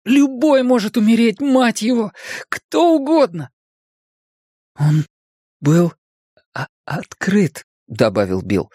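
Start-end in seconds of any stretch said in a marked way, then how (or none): 0.0-3.5 very high-pitched, panicked squeal; shriek. A narrator's reading. Richie's mind screams that anyone can die, shrieking. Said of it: "взвизгнул его рассудок". 4.8-7.6 higher, halting stammer; stuttering. A narrator's reading. Bill tells Richie about the album, stuttering.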